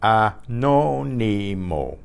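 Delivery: Anónimo stretched slowly, ah-NO-nee-mo; slow Spanish model of Anónimo, stress on NO; slow word demo. Shown as a demonstration. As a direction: draw out each syllable of Anónimo for a learner. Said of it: "Here's a word for you".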